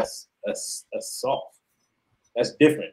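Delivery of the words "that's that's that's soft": whispered, dripping with scorn; scornful whisper. Real, not acted. Podcast host to co-hosts, dismissing the player in a scornful whisper. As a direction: breathe it out like a verdict.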